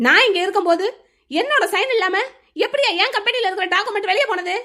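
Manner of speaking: high-pitched, indignant demand; angry disbelief. Acted. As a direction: demand an explanation, voice climbing high. Boss confronts grandfather over documents.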